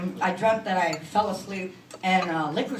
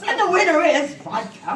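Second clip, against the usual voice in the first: mock announcer; 9 semitones higher, 7 dB louder than usual.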